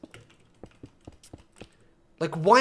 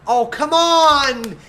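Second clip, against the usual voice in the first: slow, much louder and higher yell; frustrated shout; 9 semitones higher, 9 dB louder, much slower than usual. Real, not acted. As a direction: shout it out as the plan fails. Minecart run fails.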